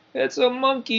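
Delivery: put-on high excited yell of a boy. A narrator's reading. Boy in the crowd yelling at the racing monkey, high excited shout. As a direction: shout it out, amazed. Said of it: "yelled the boy".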